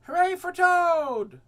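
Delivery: loud, high, slow cheer; a small frog cheering at the top of his voice; shout. A narrator's reading. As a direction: cheer Toad on from the theater. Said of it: "and he shouted".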